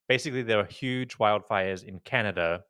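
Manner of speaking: ordinary talk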